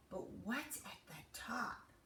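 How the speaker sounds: unvoiced wondering whisper. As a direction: breathe it in awe.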